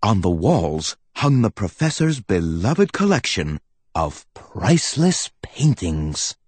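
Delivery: plain reading voice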